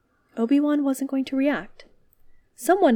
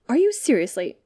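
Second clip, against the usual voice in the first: flustered, halting, pitch jumping up; stammering; 7 semitones higher, 3 dB louder than usual. A narrator's reading. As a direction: stammer out indignant fragments. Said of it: "Obi-Wan stammered".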